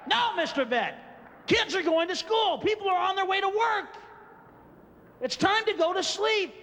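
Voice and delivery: high stern scolding; scolding owner. Pretend. Scolding his car in the morning, high stern voice. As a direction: tell the car off firmly.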